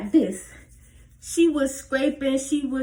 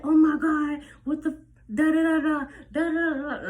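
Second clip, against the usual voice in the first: lightly voiced panicky mama, higher; panicking mama; 5 semitones higher than usual. A hint of a frazzled mother. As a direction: rattle it off quickly like a frantic parent. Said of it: "She panicking".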